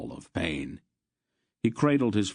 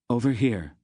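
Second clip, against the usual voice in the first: louder, higher sharp call; urgent summons across the hilltop; 5 semitones higher, 5 dB louder than usual. A narrator's reading. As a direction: call them over sharply. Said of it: "Aunt Paul called sharply".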